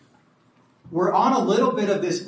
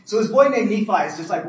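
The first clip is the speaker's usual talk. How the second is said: ordinary talk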